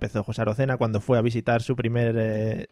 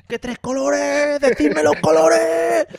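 excited shout; 12 semitones higher, 4 dB louder, much slower than usual. Acted.

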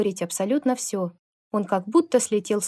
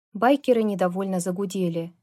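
ordinary delivery

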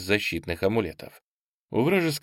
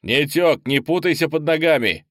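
loud, raised-pitch scolding; impatient shout; 7 semitones higher, 5 dB louder than usual. A narrator's reading. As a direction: snap loudly at someone in your way. Shoving a slave aside in battle. (раздражённый громкий окрик)

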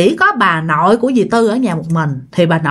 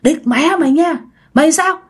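raised-pitch defiant grandmother; acted indignant telling-off; angry scolding; 7 semitones higher than usual. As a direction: curse back at a threatening ghost. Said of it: "Bà nội mới chửi nha".

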